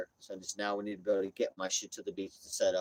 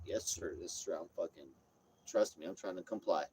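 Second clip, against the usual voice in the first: real nice; 4 semitones lower, 8 dB quieter than usual. Retold or imitated. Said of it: "So you gotta be real nice".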